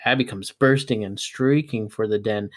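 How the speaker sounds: usual speaking voice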